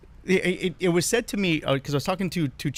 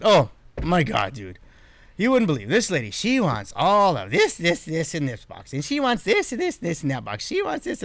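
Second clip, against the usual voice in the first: mock the demanding lady with growing disbelief; gossiping complaint; 3 semitones higher than usual. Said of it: "And I said".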